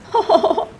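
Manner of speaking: short, high cunning chuckle; sly laugh. Acted. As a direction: laugh at your own trick. Sly crow laughs about trick.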